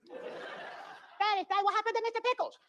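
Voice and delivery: put-on high, small child's voice asking; a wide-eyed child pleading with dad; little kid voice. A child asking dad about the dead pet, high and small.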